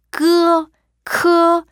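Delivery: 끄 크 said high from the back of the throat; throat sounds demo. Shown as a demonstration. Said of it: "이렇게 발음하시면 되고요".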